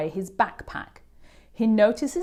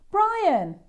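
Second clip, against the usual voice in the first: high, louder call of his name; shout; 12 semitones higher, 3 dB louder than usual. A narrator's reading. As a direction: shout across the lunchroom. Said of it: "he hears someone shout".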